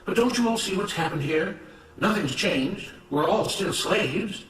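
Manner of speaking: rally fellow workers with indignant conviction; impassioned rebel worker. Acted. Rebel worker urging the grumbling crowd to see they're slaves, impassioned. Agitator's speech in story.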